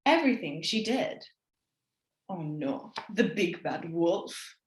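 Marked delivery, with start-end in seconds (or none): 2.3-4.6 mock dread. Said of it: "narrator's own fearful aside".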